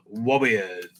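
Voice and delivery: usual speaking voice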